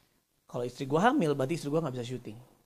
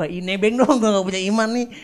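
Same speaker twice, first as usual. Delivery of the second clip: high, loud comic begging; mock pleading; 7 semitones higher, 11 dB louder than usual. Husband begging to ride on his wife's faith, mock wailing. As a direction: whine it loudly, rising to a mock wail.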